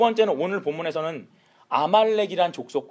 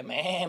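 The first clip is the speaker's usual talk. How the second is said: drawn-out sheep bleat; imitated bleating; 6 dB quieter than usual. Preacher mimicking the spared sheep, bleating. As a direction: mimic livestock crying in the background. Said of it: "저 뒤에서 울고 있는 양과 소".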